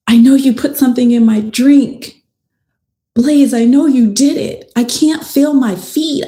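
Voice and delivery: high, urgent, distressed pleading; acting out a frantic victim, voice pitched well up; retold dying accusation. Best friend's last words to Blaze over the phone, retold as frantic accusation. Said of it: "this was Jade's last dying words".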